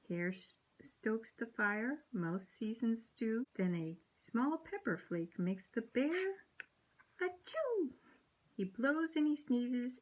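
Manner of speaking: ordinary delivery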